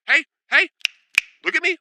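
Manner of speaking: high, loud, sudden "hey, hey"; sharp mom warning. Retold or imitated. Mom catching misbehaving twins, sharp high warning. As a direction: snap their attention to you like a strict mom. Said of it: "she would say".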